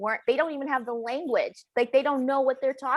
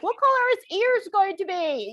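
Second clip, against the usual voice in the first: mock royal question; 10 semitones higher than usual.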